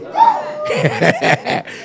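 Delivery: real high burst of laughter; delighted laugh. Real, not acted. Preacher laughs at the story.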